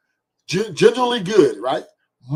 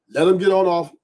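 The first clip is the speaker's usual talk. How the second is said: ordinary talk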